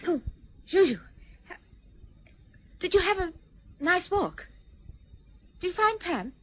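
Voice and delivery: high, flustered, falsely cheerful; flustered brightness. Acted. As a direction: cover the embarrassment with bright chatter. Sudden bright fluster at interruption.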